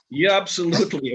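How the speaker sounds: high, loud eager yes. Retold or imitated. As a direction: jump at the offer. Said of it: "And I said".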